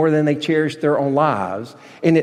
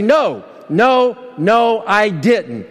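sharply higher, the last words dragged out slow; a wrongly accused man refusing the charge, firm and insistent; emphatic denial; 5 semitones higher, much slower than usual. Pretend. Falsely accused man answering the officer, emphatic slowed denial. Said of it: "It's that emphatic".

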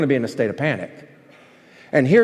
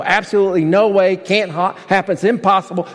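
normal talking voice

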